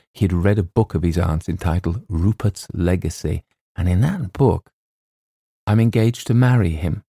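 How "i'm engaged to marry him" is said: ordinary delivery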